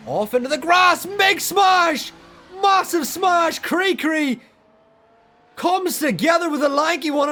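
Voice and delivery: high, loud excited crash call; motorsport commentator. Real, not acted. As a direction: yell it like a huge shunt just happened. Live crash call, safety car.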